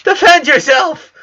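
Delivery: high, loud cry of defend yourself; a girl screaming at her sensei to fight; shouted demand. Acted. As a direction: yell at him to fight back.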